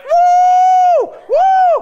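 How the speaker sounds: very high, loud whoops; gleeful whoop. Retold or imitated. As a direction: cheer like you got away with something. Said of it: "she's like".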